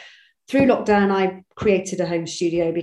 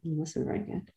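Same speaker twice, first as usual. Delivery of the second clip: much softer, lightly voiced doubtful thought; muted private verdict; 3 semitones lower, 13 dB quieter than usual. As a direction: say it under your breath, as if to yourself. Imagined private verdict on book.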